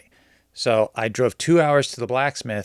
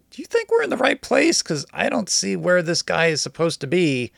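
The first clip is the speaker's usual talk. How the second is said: high questioning voice; 6 semitones higher than usual. Retold or imitated. Own worried question, retold.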